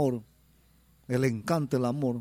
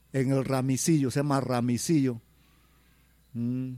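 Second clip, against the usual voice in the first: ordinary talk, nothing marked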